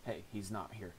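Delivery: ordinary talk, nothing marked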